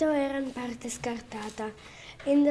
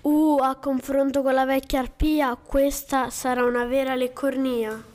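louder, relishing, hungry wolf; gloating wolf; 6 dB louder than usual. Acted. The wolf gloating to himself over the girl, relishing. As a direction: savour the word leccornia like a treat.

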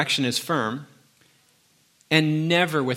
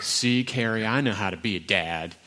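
smug inner voice; 2 semitones lower than usual. Retold or imitated. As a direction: gloat at your wife. Gloating inner voice at wife.

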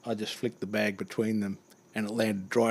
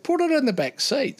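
panicked squeal; 4 dB louder than usual. Retold or imitated. Driver ordering in panic.

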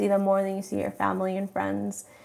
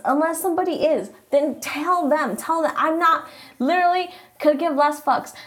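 higher and louder, fired up; heated rant; 7 semitones higher, 4 dB louder than usual. Real, not acted. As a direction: let the irritation boil over. Ranting, voice rising high.

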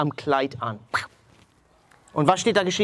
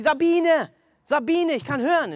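excited calling; 11 semitones higher than usual. Retold or imitated. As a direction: call across to the daughter in joy. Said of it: "auf einmal schreit sie". (hoher, aufgeregter Ruf nach der Tochter)

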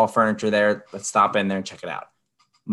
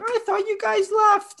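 very high, slow, sing-song; squeaky saleswoman impression; mock saleswoman; 4 dB louder than usual. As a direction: stretch it out like a fake-surprised clerk. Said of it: "she's like".

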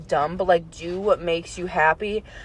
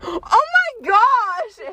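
gasping surprise; 13 semitones higher, slower than usual. Real, not acted. Shocked realization.

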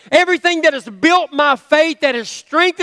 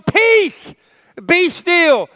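a much higher ringing command; commanding the storm; 5 semitones higher, much slower than usual. Retold or imitated. Jesus rebuking the wind and sea, a high commanding call. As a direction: call the sea to stillness with full authority. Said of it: "he's standing up and he's going".

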